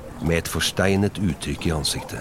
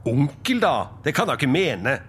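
higher, good-natured protest; exclaiming in protest; 6 semitones higher than usual. Acted. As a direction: object in lively disbelief to uncle. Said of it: "utbrøt Fred". (lys, forbløffet protest)

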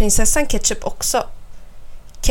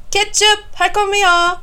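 high, raised calling-out; excited shout; 10 semitones higher, 4 dB louder than usual. A narrator's reading. Snurran runs up the stairs to eat, shouting eagerly. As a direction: call ahead eagerly at the top of the voice. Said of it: "skriker jag".

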